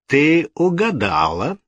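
high, slow, gloating drawl; cruel smile. A narrator's reading. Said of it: "улыбаясь с жестоким выражением".

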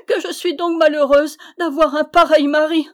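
high, loud, tearful wail; sobbing lament. A narrator's reading. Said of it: "elle pleurait à sanglots".

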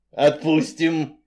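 slow, half-hearted promise; unconvincing. Acted. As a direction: say it without meaning it. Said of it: "не очень убедительно кивнул".

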